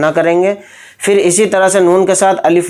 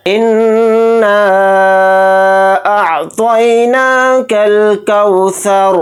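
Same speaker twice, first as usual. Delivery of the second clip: slow, drawn-out chanted tilawat; Quranic recitation; 3 semitones higher, much slower than usual. Shown as a demonstration. Teacher opening the lesson, slow chanted recitation. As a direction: intone each word slowly and reverently. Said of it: "पहले इस तरह से हमें पढ़ना है पूरी आयत को".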